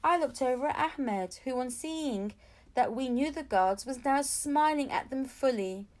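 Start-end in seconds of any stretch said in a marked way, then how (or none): none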